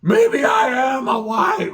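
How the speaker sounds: high, slow, loud yell; full-on mock shout. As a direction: bellow it out in full rage. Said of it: "I went into full fucking".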